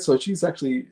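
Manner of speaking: normal talking voice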